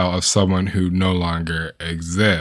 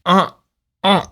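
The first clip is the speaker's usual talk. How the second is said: stuck on "uh", too disgusted for words; grossed-out stammer; 5 semitones higher, slower than usual. Real, not acted. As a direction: sputter in disgust, can't form a sentence. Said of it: "I am too grossed out to even speak".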